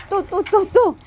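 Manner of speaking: high, quick repeated exclaim. Real, not acted. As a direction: call out at the puppy in a high voice. Reacting to the puppy's mischief.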